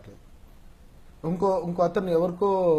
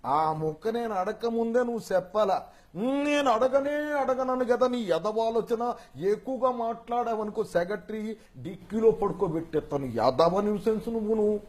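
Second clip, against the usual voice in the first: Rao Gopala Rao; 5 semitones higher than usual. Acted. Boss threatens his secretary, high drawling scolding impersonation. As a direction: berate in a bold, stretched villain voice. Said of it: "Rao Gopalarao గారు".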